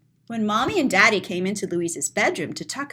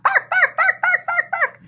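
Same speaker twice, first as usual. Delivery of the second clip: high, yappy mock dog barks; dog barking. Reading the story, imitating the barking dog. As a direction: bark like a little excited dog.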